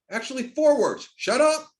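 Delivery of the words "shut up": "Shut up" barked out high and loud. Shown as a demonstration. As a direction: snap "Shut up" like an order. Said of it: "You need to know two words".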